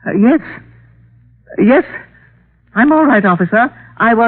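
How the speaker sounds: high, strained, forced calm; trying to sound fine with a gun on her; scared. Acted. Held at gunpoint on the phone.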